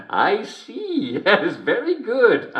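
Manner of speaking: high, drawn-out delighted realisation; light up as the joke finally lands; dawning amusement. Acted. Belatedly gets the joke.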